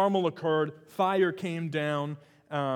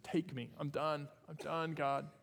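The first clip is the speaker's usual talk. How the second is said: quiet weary despair; 9 dB quieter than usual. Retold or imitated. Elijah asking God to die.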